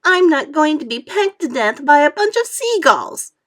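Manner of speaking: loud, high tearful protest; frightened worm protesting loudly; crying out. A narrator's reading. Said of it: "cried the earthworm".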